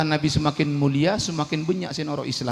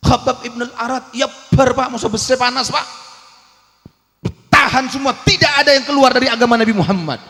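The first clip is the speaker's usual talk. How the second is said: high, loud sermon cry; preaching at full force; 10 semitones higher, 11 dB louder than usual. Acted. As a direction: thunder it to the crowd.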